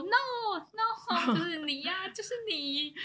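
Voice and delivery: high, insistent "no, no, it's you"; insistent mock protest. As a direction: stubbornly insist like the tipsy guest. Imitating insistent guest's "No.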